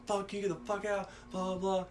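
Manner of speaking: soft, high-pitched mock yell trailing into filler; retold yelling. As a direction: mimic your own yelling at reduced volume. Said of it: "So I was yelling at her".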